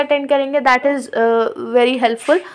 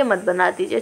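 quiet, low don't; soft warning; 7 semitones lower, 6 dB quieter than usual. Shown as a demonstration. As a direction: drop the voice on मत. (धीमे से मना करना)

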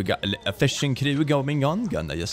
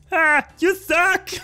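slow, much higher yell through laughter; mock heckle; 5 dB louder, slower than usual. Heckling [name] alone at the show, laughing yell. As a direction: boo him from the empty stands. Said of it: "it would just be me like".

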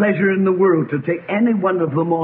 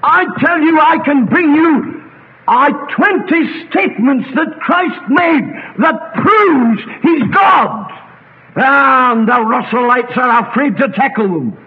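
high, loud, emphatic declaration; thundering sermon climax; full-force preaching; 8 semitones higher, 5 dB louder than usual. Real, not acted. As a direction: hammer out each phrase slowly to the congregation.

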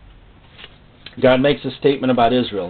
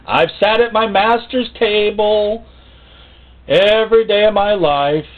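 high, slow, self-satisfied pet voice; smug dog voice; 10 semitones higher, 4 dB louder, slower than usual. Acted. The church dog boasts to the congregation in a high, slow voice. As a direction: play the pampered dog bragging.

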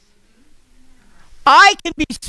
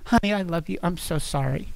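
soft apology; 6 semitones lower, 10 dB quieter than usual. Retold or imitated. Apology after a quarrel.

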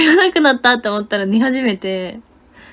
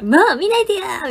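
high, whiny plea not to be looked at; teasing impression of the embarrassed bandmate; bashful friend; 10 semitones higher than usual. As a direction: mimic a shy friend begging you not to look. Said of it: "みたいな".

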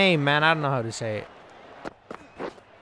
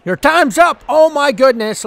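much higher and louder triumphant cry; triumphant yell; 10 semitones higher, 11 dB louder than usual. Real, not acted. Bowler taking Williamson's wicket, triumphant yell. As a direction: crow as the wicket falls.

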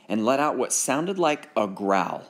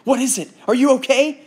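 high, loud, frantic; worried brother asks fast; near panic; 13 semitones higher, 7 dB louder than usual. Acted. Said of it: "Ben felt near panic".

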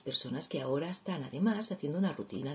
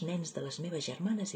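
ordinary delivery

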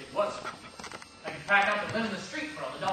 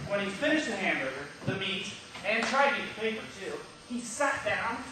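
ordinary talk, nothing marked

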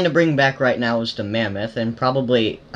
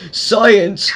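mock tipsy lecturer shout; imitating drunk teacher; 4 semitones higher, 3 dB louder than usual. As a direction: parrot the slurred classroom cry. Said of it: "you sound like a drunk man that's, like, teaching the science class".

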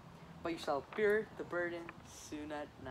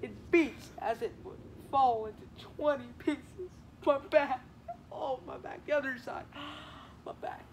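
aching and moaning; 5 semitones higher than usual. Acted. Nurse moans about her back.

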